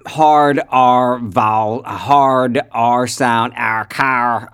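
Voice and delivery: loud, slow, hard R in "R" and "car"; hard R sound. Shown as a demonstration. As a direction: lean long and hard on the R in "car". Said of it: "they have a very, um, hard R".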